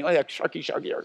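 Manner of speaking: ordinary talk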